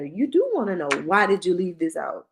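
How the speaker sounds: normal talking voice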